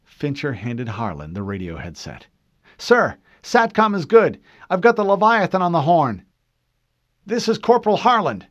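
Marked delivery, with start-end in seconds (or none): 2.8-6.2 higher, louder soldier reporting; brisk report to the corporal. A narrator's reading. Radio operator reports to corporal, higher and louder. 7.2-8.4 higher, louder radio call; corporal on radio. A narrator's reading. Corporal calls the fleet over radio, higher and louder. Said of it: "he spoke into the microphone".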